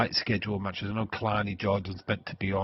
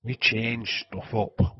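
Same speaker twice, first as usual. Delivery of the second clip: normal talking voice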